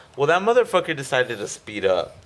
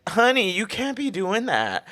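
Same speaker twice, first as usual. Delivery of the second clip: country lady; 9 semitones higher than usual. Retold or imitated. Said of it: "like, country lady".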